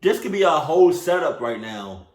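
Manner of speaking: loud, high-pitched exasperated warning; pissed warning. Real, not acted. Viewer warning the story's hero of a trap, pissed. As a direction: raise the voice and warn indignantly. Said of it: "this is why I'm pissed".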